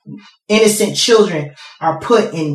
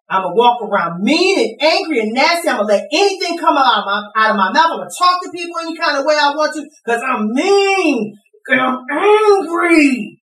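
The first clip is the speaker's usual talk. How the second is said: anguished angry prayer; 8 semitones higher than usual. Acted. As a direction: beg God, then wail out the anger. Acted prayer, building to angry cry.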